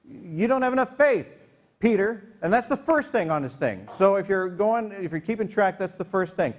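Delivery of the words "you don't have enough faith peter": mock scolding. Jesus scolds Peter for lacking faith after he sinks, mock scolding. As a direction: imitate a teacher scolding a follower. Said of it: "Jesus has to scold him and say".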